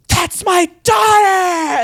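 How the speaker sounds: high, slow, loud shouted claim; overacted dramatic roar; hammed-up father's shout. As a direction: bellow it like a movie father standing up. Father rises from wheelchair, retold.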